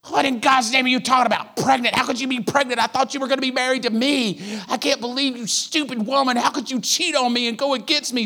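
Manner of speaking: outraged fiancé; jilted outrage. Retold or imitated. Joseph reacting to pregnancy.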